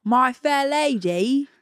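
loud, drawn-out caricature Cockney; Cockney accent. Pretend. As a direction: belt it slowly in broad Cockney. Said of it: "the accent is Cockney".

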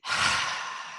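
soft, voiceless exasperated sigh; imitating Johnny's sigh. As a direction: sigh at them as if tired of it all. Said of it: "I can't do the voice, but I'll do the tone".

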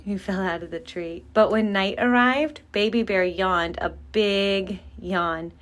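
usual speaking voice

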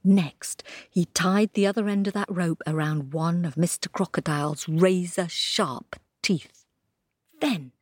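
ordinary delivery

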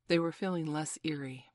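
ordinary delivery, nothing marked

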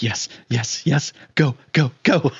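mock control-room cheer, yes yes go go go; excited cheering. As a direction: cheer the landing on like a mission scientist. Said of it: "that's how I was feeling".

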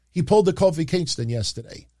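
usual speaking voice

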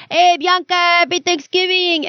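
put-on dad greeting his daughter in a Mexican accent. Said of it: "thick Mexican accent".

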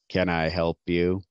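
a hint of a wary guard at the stairs; a touch of a policeman stopping a stranger; constable. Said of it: "a man in a constable's uniform".

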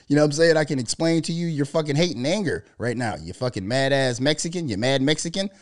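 ordinary talk, nothing marked

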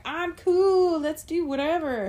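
lightly voiced laid-back surfer dude; a hint of a chill surfer guy. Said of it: "It was a really bad surfer voice".

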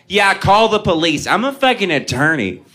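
put-on a cocky drunk woman sure she's untouchable; mock confident lady. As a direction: toss it off with total self-assurance. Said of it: "responds with the utmost confidence".

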